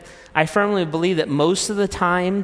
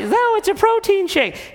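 wife's voice. Acted. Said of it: "And she".

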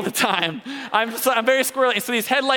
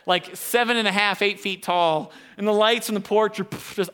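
ordinary delivery

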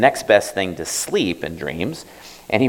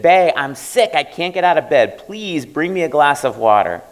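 higher, pleading sick mother; 8 semitones higher, 3 dB louder than usual. Acted. Mother calling her son from her sickbed, higher and pleading. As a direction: call weakly from the sickbed. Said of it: "She said".

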